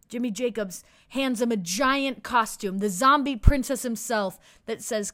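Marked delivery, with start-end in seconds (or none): none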